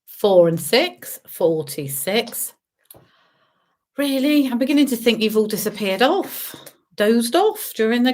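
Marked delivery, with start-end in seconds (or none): 0.0-2.5 bingo caller. Acted. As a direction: sing out the numbers. Bingo calls.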